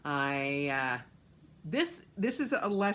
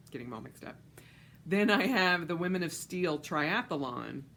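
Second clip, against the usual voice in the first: ordinary delivery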